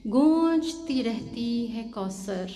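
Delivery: slow, drawn-out mushaira couplet; ghazal recitation. Acted. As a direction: stretch the verse out for the listeners.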